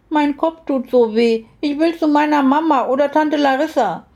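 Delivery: put-on high, wailing little-girl voice; sobbing child. A narrator's reading. Hurting little girl begs for her mother, acted sobbing. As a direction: plead for mama in a hammed-up child's whine. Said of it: "schluchzte die Kleine".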